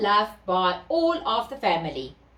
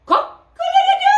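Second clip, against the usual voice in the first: high, loud, fast cock-a-doodle-doo; loud rooster crow; 9 dB louder, faster than usual. A narrator's reading. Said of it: "with a very loud".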